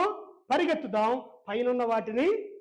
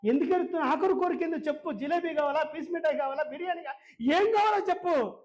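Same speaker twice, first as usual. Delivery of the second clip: higher-pitched, rising exasperation as he presses her; retold pestering grandson, pitched up; insistent questioning; 6 semitones higher than usual. Grandson pressing his crying grandmother for a last wish, insistent.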